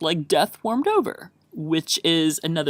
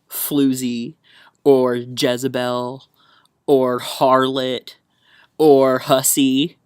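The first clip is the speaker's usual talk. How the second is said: slow deliberate list; 5 dB louder, much slower than usual. Shown as a demonstration. Listing words slowly, growing louder.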